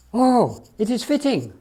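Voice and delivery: happy cry of relief; cried out. Acted. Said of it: "cried Banny".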